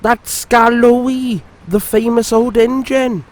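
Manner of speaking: a sweet little engine being kind; friendly Peter Sam cheering up his grumpy friend. A narrator's reading.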